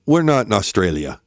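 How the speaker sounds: ordinary delivery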